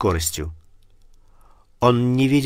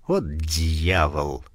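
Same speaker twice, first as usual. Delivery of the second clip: low, slow, weary muttered curse; gloomy curse; 6 semitones lower, much slower than usual. Acted. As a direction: drop it low and slow, fed up. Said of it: "тоскливо подумал он".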